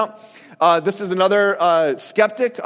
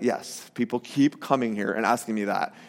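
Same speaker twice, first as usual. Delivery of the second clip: low, subdued, put-upon reply; lightly acted weariness, quiet and low; mock weary; 9 semitones lower, 7 dB quieter than usual. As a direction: play tired of being asked, voice dropped. Voices Simon fed up with askers.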